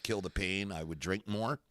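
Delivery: usual speaking voice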